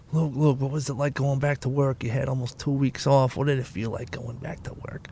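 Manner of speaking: low, hushed imitation of questioners; quiet put-on questioning voice; mock nosy questioning. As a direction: drop low and soft to mimic everyone asking. Mimics everyone asking him.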